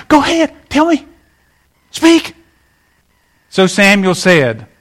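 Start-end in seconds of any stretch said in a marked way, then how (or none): 0.1-2.4 much higher, huffy 'go ahead'; sulky permission to speak; impatient Saul. Retold or imitated. Saul grudgingly letting Samuel speak, huffy and higher. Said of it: "Saul can't even be quiet".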